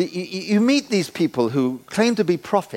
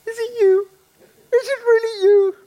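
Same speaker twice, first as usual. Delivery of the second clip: much higher, delighted mock girlish voice; imitating girlfriend on phone; 4 dB louder than usual. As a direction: squeal with disbelief on the phone. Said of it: "here comes this voice".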